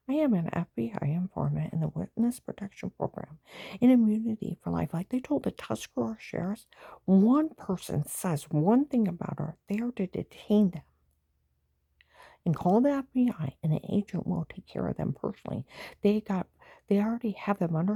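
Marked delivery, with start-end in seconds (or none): 3.8-13.6 heated rant. Real, not acted. Heated rant about protection.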